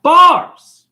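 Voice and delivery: high, loud shouted punchline; yelled catchphrase. Acted. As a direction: bellow it as a mic drop. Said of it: "Jay, why are you yelling?".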